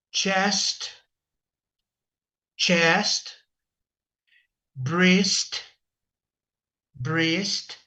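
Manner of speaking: each word said twice, slowly and clearly, rising; pronunciation drill. Shown as a demonstration. Teacher drilling body-part words for students to repeat. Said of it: "just to let you know about the pronunciation".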